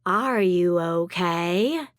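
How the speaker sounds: put-on southern accent. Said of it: "her thick southern accent".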